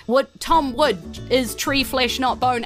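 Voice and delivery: ordinary delivery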